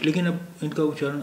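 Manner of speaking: normal talking voice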